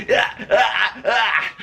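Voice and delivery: mock gasping while flailing in a headlock; comic choked grunts; imitated choking gasps. Imitating man struggling in headlock.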